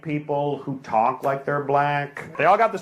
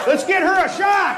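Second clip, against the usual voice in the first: much higher, louder call across the room; 14 semitones higher, 4 dB louder than usual. Real, not acted. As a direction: shout to the bar to bring a drink. Calling to bar staff in room.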